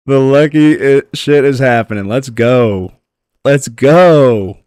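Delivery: loud, stretched-out hype; excited celebration. Real, not acted. Streamer celebrating a lucky drop, excited cheering.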